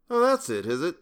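high, humouring lilt; high-pitched indulgent flattery; flattering voice. A narrator's reading. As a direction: flatter him as if humouring a patient. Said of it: "said Lewis in that flattering voice".

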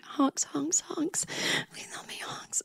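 desperate whisper to a stuffed duck. Retold or imitated. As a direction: plead in a breathless whisper. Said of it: "And I'm whispering to it".

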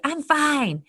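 high, indignant protest; mock defensive retort. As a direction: act out a touchy denial. Acted defensive retort when called out.

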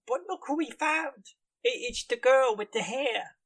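high-pitched lackey voice; apologetic henchman. Acted. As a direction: play a thug groveling to his boss. Game master voices guildmaster's underling.

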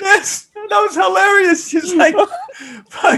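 high, loud laughter through the words; can barely talk for laughing; laughing hard. Real, not acted. Friends recalling a funny scene, laughing hard. Cracking up over movie scene.

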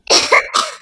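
loud, hammed-up cough; fake cough. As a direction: cough it out big. Virus character fakes a cough.

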